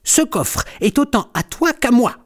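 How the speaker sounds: high, indignant kitten voice; squabbling storybook kitten; grumpy protest. A narrator's reading. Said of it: "rouspète Griffounette".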